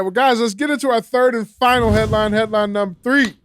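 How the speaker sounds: loud, high segment announcement; big, projected announcer voice; show announcer. Acted. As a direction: belt out the next headline like a host.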